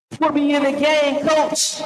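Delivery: very high, slow processed plea; high-pitched effect voice; voice-changer voice. Pretend. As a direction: squeal it through a pitch-shifting effect. Streamer testing voice changer before call.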